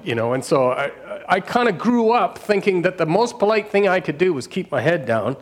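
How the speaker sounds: usual speaking voice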